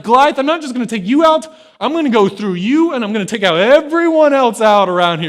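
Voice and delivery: loud, high-pitched mock challenge. David threatens Goliath and his army, loud smack talk. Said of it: "the coolest smack talk".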